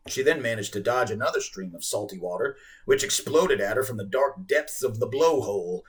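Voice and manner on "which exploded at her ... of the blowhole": ordinary delivery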